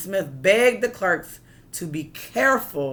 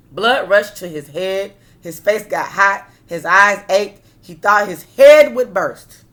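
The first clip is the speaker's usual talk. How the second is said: building tension; 10 dB louder than usual. A narrator's reading.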